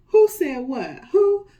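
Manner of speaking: loud, high demanding lion voice; lion roaring a question. A narrator's reading. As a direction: bellow it like an angry lion king. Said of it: "Lion said".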